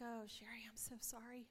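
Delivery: hushed, tender apology; soft sympathy. Retold or imitated. To sick friend Sherry, soft tender sympathy. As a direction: comfort her gently. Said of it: "I was like".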